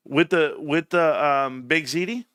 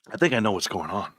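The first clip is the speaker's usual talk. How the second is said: normal talking voice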